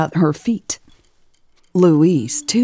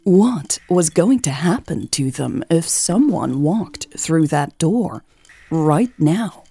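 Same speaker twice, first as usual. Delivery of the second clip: ordinary delivery, nothing marked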